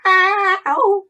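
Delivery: high, loud imitated yowl; loud cat meow. Shown as a demonstration. Gamer making the cat's meow herself, loud high yowl. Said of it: "I'll make the noise".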